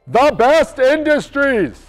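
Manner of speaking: high, loud, drawn-out shout; all-caps yell. Real, not acted. Host yelling a company name written in all caps. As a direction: yell every word at full volume. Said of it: "you have to yell it".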